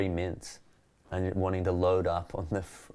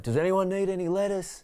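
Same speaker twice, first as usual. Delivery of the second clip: much higher hawker's cry offering lettuce; calling out; 12 semitones higher, 4 dB louder than usual. Retold or imitated. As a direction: sing it out to the whole tram like a vendor. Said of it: "be like".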